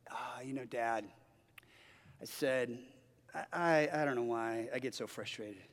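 hushed, humbled admission; soft apology. Retold or imitated. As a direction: own up gently to your dad.

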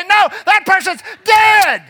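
slow, shouted high denial; triumphant slow shout; defiant shout. Acted. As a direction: bellow it back at the accuser. Preacher acting defiant reply.

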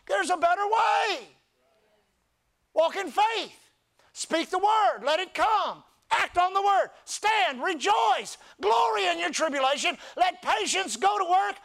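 high, loud commanding sermon; rally the church with each command; full-force preaching. Acted.

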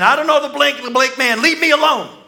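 high, loud, heated denial; angry denial. Acted. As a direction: spit out the denial like a cornered man. Peter cursing, denying Jesus.

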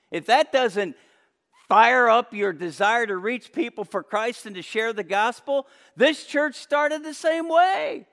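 loud, high, fervent preaching; full-force preaching. Real, not acted.

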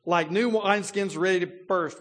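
slow, loud and high, bottled-up pressure; ready to burst. Retold or imitated. The preacher voicing Elihu's bursting pride, loud and slow. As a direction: let it swell like fermenting wine. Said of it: "look how he describes it".

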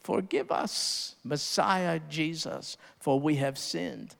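soft, slow imagined repentance; humble plea. Retold or imitated. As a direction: beg forgiveness softly on your knees. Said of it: "fall on their knees and say".

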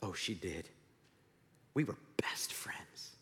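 acted tearful near-whisper; very soft, halting, as if starting to cry; Bing Bong. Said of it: "Bing Bong starts crying".

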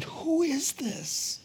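breathless, hushed and high, slowly; awed whisper. Acted. Disciples stunned by Jesus calming the storm, awed whisper. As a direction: gasp it out in wonder.

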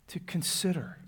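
hushed sermon whisper. Acted. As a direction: whisper it, unvoiced and intimate.

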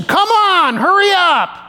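very high-pitched holler; imitated irate driver shouting; loud impatient yell. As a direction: yell out the window to hurry them. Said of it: "I mean, it was loud".

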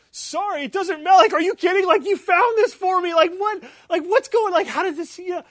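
very high, very loud elated outburst; freaking out. Retold or imitated. Father to daughter who found his lost work, freaking out. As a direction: shout it in overjoyed disbelief, words tumbling. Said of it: "and I'm freaking out".

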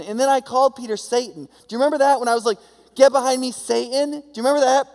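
hammed-up indignant Jesus; exasperated Jesus. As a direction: drive it home like a frustrated teacher. Jesus scolding his disciples.

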